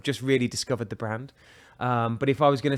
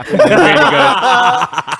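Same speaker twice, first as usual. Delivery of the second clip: laughing; 11 semitones higher, 12 dB louder, much slower than usual. Real, not acted. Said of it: "I think it's great".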